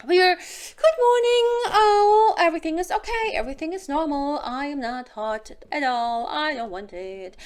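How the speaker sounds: high, prim mock denial; lightly acted innocent good morning, insisting nothing is wrong; mock prim woman. Mocking how women really wake up, high and prim. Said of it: "we do not wake up and say".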